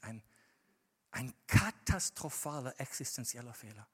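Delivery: usual speaking voice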